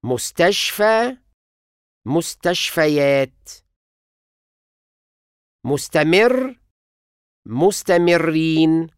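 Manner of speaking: slow, careful example words; stress shift demo. Shown as a demonstration. Teacher showing stress shift with a suffix. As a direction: show the stress moving to the suffix. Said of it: "وبيتنقل لما لحقة بحركة طويلة".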